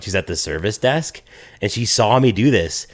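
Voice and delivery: ordinary delivery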